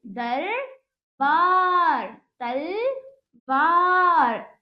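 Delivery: drawn-out words, each one set apart; slow clear dictation. Acted. Teacher to pupils, slowly modelling the long-a words. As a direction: stretch each word so children catch it. Slow word list for children.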